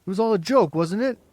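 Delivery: loud, high mocking; mock her outright; mocking cry. A narrator's reading. Boy mocking Margot in front of the others. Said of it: "he cried".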